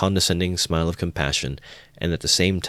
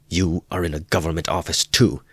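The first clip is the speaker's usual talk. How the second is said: mild retort. A narrator's reading. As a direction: throw the words back at the officer. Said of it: "cried Raskolnikov".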